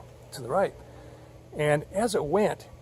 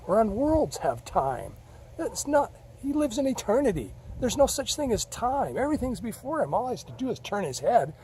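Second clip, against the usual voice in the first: higher, loud at first, then settling; a lecturing God, calming as he explains; God answering; 8 semitones higher, 5 dB louder than usual. Retold or imitated. God correcting Scott's doubt, high and lecturing.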